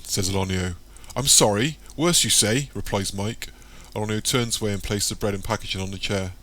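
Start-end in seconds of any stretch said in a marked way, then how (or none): 1.1-2.7 high, louder disbelieving echo; young Michael, startled and pitched up; taken aback. A narrator's reading. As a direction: catch the word worse in alarm. Michael reacts to threat.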